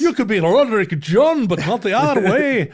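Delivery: mock Scottish accent. Joining the joke as a Scots elder, mock Scottish accent. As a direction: play along as a stern Scottish clansman.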